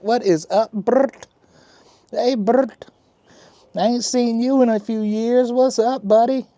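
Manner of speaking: high, loud pet-greeting voice; baby talk to pet. Acted. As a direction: coo up at the cat like baby talk. Greets the cat Bert.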